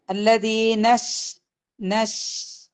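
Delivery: slow chant stopping on the sh; slow tajweed chant; Quran recitation. Shown as a demonstration. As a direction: recite and clip it on the sukoon. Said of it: "നൂനിന് ഫത്തയ കഴിഞ്ഞിട്ട് എങ്ങോട്ട് പോരും?".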